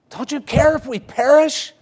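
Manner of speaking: high, loud frightened plea; panicked disciples. Acted. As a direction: cry out like men about to drown. Disciples accuse Jesus in storm.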